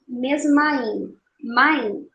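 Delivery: "mesma in, main" slowly, A and I fused; junção de vogais. Shown as a demonstration. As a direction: demonstrate "main" as one joined syllable out of "mesma in". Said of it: "a junção das vogais A e I". ("mesma in, main" devagar, juntando A e I)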